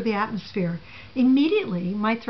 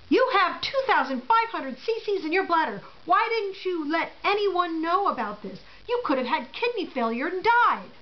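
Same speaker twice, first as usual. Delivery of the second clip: imitating a nurse, pitched higher and scolding; lightly voiced alarmed nurse telling off a patient; 7 semitones higher than usual. Nurse scolding the paralyzed patient over her bladder, mock alarmed. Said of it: "she blurted out".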